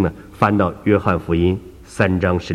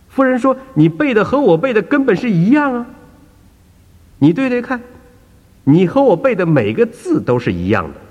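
put-on much higher, incredulous elderly lady; a raised, baffled old woman; insistent old woman; 13 semitones higher, 3 dB louder than usual. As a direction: insist every word matches.

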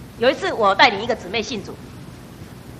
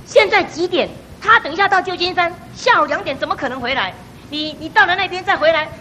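sharp, higher, indignant questions; fuming at the news, voice raised; angry retort; 7 semitones higher, 4 dB louder than usual. Retold or imitated. Mother vents anger at the absent churchgoer, indignant. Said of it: "我一听了很生气呢".

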